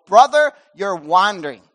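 loud, direct rebuke; blunt in-your-face call. Retold or imitated. Pastor showing how to confront him, loud and blunt. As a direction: say it straight to his face. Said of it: "come straight in my face and go".

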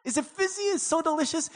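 high, gushing mock enthusiasm; eager server. Server thrilled to bring a refill, hammed-up eagerness. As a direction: gush like an over-keen server.

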